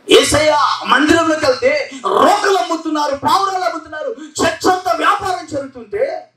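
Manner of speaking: high, ringing preacher's shout; fiery preaching. Acted. Preacher to his congregation, high fiery shout. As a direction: preach it out at full force.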